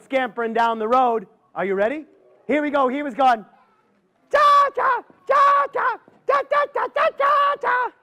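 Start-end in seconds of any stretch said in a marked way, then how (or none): 4.3-7.9 very high squeaky squirrel chattering. Acted. Squirrel scampering down the road, very high chattering.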